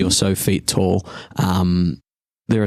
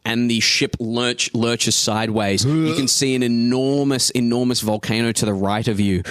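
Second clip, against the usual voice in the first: ordinary talk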